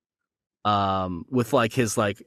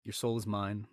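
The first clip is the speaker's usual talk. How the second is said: joking villain line; 3 semitones lower, 11 dB quieter than usual. Pretend. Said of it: "No, I'm joking".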